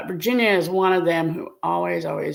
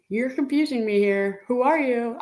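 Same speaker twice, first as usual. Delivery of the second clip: ordinary delivery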